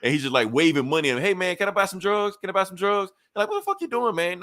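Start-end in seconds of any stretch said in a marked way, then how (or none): 1.2-3.1 a hint of an old man, pitched higher; lightly voiced old man begging to buy; disguised old man. Captain America disguised as an old man asks for drugs. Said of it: "in disguise right now like an old man". 3.5-4.4 very high mock-shocked squeak; shocked dealer. Dealer shocked by the old man begging for drugs. Dealer reacts to disguised buyer.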